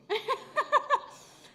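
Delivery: high, loud burst of laughter. Real, not acted. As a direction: laugh at herself. Laughs at herself.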